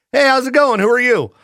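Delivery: high cheerful greeting; mock greeting. Partygoer meeting the reporter, chirpy hello. Acted small-talk greeting.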